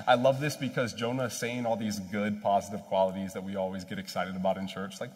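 ordinary delivery

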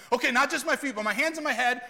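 high, loud, over-eager blurting; eager, immediate turnaround. Acted. Peter instantly reversing himself to Jesus, over-eager outburst. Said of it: "imagine Peter immediately saying those things".

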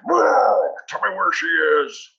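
loud, high-pitched hammed-up menace; mock scary yell. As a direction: jump out and roar a demand in character. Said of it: "it'd be pretty scary".

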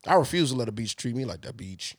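mock Indian accent on beach. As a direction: slip into an Indian accent mid-sentence. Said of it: "I turn Indian in the middle of that sentence".

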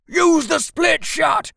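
high, slow soldier's shouted order; loud gung-ho soldier. Acted. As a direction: yell the order over battle noise. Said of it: "yelled the soldier".

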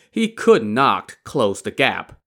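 ordinary delivery

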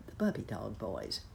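plain reading voice

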